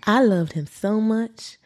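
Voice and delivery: attempted American Southern accent. Podcast host quotes the accused woman, attempting a Southern accent. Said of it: "I wonder if I can do a southern accent".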